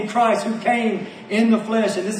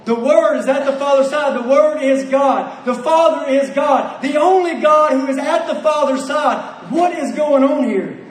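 full-force preaching; 2 semitones higher than usual. Acted. Preacher astonished at John's words to the congregation, forceful preaching. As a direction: hammer each claim home.

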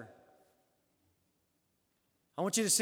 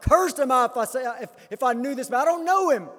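loud, high oath of denial; forceful cursing; 5 semitones higher, 7 dB louder than usual. Acted. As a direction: call down curses on yourself in panic. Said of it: "Affirming it with some force and calling down curses".

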